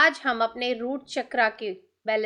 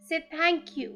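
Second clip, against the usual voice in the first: slow thanks, sinking softer; meditative affirmation, softening at the end; guided meditation; 5 dB quieter, much slower than usual. Real, not acted. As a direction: let the gratitude slow and fade. Guides gratitude to breath.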